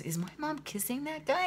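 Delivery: put-on high, small child's whisper-question; little girl voice. Little daughter asks mom about the woman kissing, in a high child voice.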